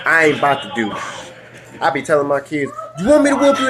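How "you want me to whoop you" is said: high, acted parental threat; mock threat. Dad threatening his kids with a whooping, mock threatening. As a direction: pitch it up sharply, warning a child.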